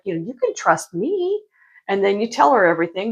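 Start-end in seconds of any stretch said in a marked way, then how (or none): 0.2-1.4 high, slow sugary mock; sing-song fake sincerity; fake trustworthy. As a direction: coax like a sly confidant. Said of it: "acting like".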